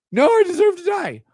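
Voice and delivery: high pleading whine; mocking whiny voice. Mocking the fan's replies.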